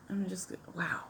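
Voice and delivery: breathless whispered wow; hushed, stunned whisper; awed whisper. Real, not acted. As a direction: whisper it in real awe. Awed by the makeup result.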